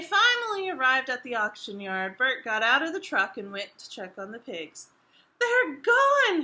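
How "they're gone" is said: high, loud alarmed cry. Acted. Bert discovering the pigs are gone, crying out loud. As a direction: shout it in sudden panic. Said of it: "he cried".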